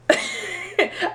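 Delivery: short high laugh at herself; real laugh. Real, not acted. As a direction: laugh at how silly she was. Laughing at her own denial.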